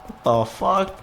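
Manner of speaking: high startled outburst; shocked. Real, not acted. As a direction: blurt it out in shock. Shocked by demon grin.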